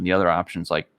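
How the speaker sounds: normal talking voice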